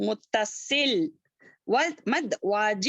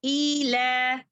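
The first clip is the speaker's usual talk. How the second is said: usual speaking voice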